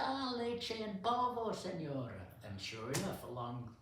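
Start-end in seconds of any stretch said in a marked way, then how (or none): 0.0-2.2 high-pitched imitation of a Spanish-speaking clerk; high "ah" of recognition, dropping at the end; helpful store clerk. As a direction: imitate a clerk who suddenly understands. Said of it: "And the guy said".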